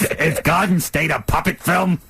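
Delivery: normal talking voice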